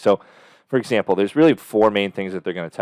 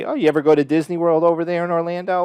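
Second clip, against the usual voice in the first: chatty rapport question; 8 semitones higher, 3 dB louder than usual. Shown as a demonstration. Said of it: "And then I would say".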